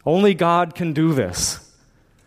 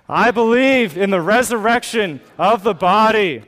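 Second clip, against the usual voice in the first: higher, louder bold declaration; really mean it; 6 semitones higher, 4 dB louder than usual. Shown as a demonstration. Pastor leading congregation's creed, louder and fervent. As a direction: proclaim "believe" with full conviction. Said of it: "One more time like you really mean it".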